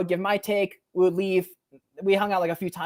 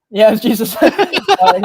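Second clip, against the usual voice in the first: laughing through the line, louder and higher; 9 semitones higher, 10 dB louder than usual. Real, not acted. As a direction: burst out laughing as you say it. Laughing at own punchline.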